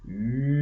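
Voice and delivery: "huile" drawn out softly while spelling; sounding out. Shown as a demonstration. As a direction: stretch "huile" slowly and quietly, every sound held. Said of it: "On entend la voyelle après".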